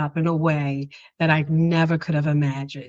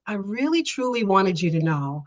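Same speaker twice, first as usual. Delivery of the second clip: normal talking voice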